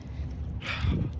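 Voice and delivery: soft, breath-only sigh; deflated sigh. Real, not acted. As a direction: breathe out in defeat. Deflated after the breakage.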